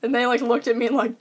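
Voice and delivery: usual speaking voice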